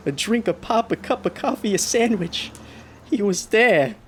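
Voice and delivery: rising dread. Acted. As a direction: panic climbing to a loud peak. Driver's panic building, haunted.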